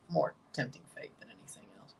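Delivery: quick unvoiced whisper; whispered aside to himself; fast whisper. Real, not acted. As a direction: rush it out under your breath. Quick aside under his breath.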